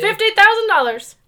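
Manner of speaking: high, loud, pushy car dealer; oily salesman steamrolling the buyer; sleazy salesman. Acted. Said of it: "my used car salesman voice".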